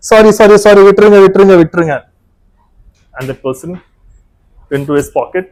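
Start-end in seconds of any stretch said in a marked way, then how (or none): 0.1-2.0 high, loud, repeated pleading; frantic apology. Retold or imitated. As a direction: imitate a friend begging to be let go. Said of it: "feeling sorry before that. That sincerely".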